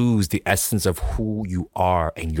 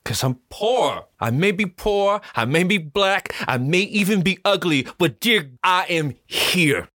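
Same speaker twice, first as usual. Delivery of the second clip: affirmation; 10 semitones higher than usual. Shown as a demonstration. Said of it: "recites affirmation".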